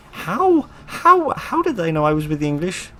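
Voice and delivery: high, bewildered how-did-they-know; alarmed disbelief. Real, not acted. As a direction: suddenly caught out and panicking. Panicked at being recognised.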